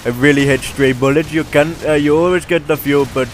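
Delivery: put-on Indian-accented game commentary voice. Gamer narrating his gameplay clips, in an Indian accent. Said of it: "this is my Indian voice".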